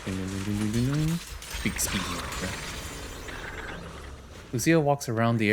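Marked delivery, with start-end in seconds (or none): none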